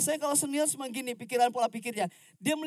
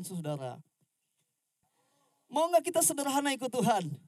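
ordinary talk

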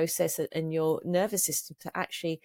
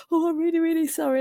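high, plaintive mock client voice; squeaky, flustered apology, put on; apologetic client; 10 semitones higher, 4 dB louder than usual. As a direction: imitate a client apologising, pitched way up. Voicing a crying, apologising client.

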